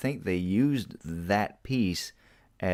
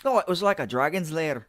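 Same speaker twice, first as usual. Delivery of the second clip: put-on high, playful Scottish accent; a quick mock Scottish brogue, pitched well up; light Scottish accent, joking about the lair; 11 semitones higher, 3 dB louder than usual. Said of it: "I did that in a Scottish accent".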